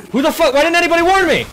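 shocked yell, pitch jumping up; surprised yell. Real, not acted. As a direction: yell in sudden alarm. Caught off guard in game.

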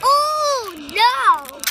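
loud, dismayed "oh no"; loud disappointed groan. Real, not acted. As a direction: cry out in disappointment. Disappointed by duplicate Shopkin.

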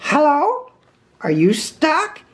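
high, questioning character voice; Rabbit. A narrator's reading. Rabbit finding Pooh stuck, high and puzzled.

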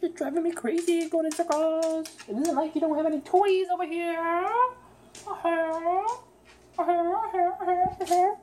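mock-scold the circling bird; playful scold. Real, not acted. Owner teasing the bird for going in circles.

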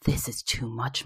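mum's subdued exasperation; weary protest. A narrator's reading. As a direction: protest softly, at the end of patience. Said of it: "my mum protested".

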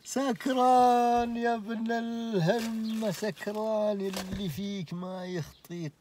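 drawn out and high, slow, in mock outrage; slow disbelieving exclamation; incredulous. Real, not acted. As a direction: stretch it out in disbelief. Exclaiming at the drunk, laughing.

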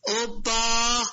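ordinary delivery

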